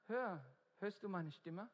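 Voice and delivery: normal talking voice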